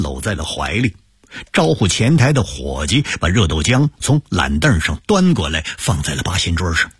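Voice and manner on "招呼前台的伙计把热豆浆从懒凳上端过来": plain reading voice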